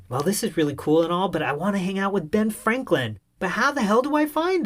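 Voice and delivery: building to a high exasperated ask; excited inner thought. Retold or imitated. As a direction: inner monologue climbing in pitch. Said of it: "I'm thinking".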